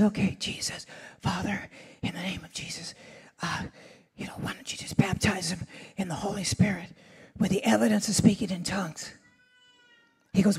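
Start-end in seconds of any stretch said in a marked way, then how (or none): 0.0-9.1 whispered prayer, barely voiced; hushed whisper. Nervous preacher praying over a doctor in a quiet church, whispering. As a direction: pray in a whisper so nobody hears. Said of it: "So I was really quiet".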